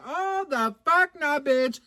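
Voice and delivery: high, drawn-out mock shock; shocked disbelief. Customer reacting to the saleswoman's attitude, high shocked refusal. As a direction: stretch it out in scandalized disbelief. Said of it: "shocked, girl".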